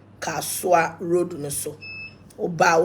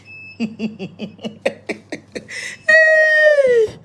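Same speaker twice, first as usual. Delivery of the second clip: high, loud burst of laughter; shrieking laugh; 9 dB louder than usual. Real, not acted. Pastor bursting into a loud, high laugh at the crowd. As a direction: let a shrill laugh rip out.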